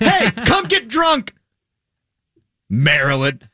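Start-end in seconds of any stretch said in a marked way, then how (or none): 0.0-1.3 slow, higher drawn-out pitch to a friend; a friend inviting you to come get drunk; mock buddy invite. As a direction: sell it like a lazy party pitch. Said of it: "Hey, what are you doing this weekend?".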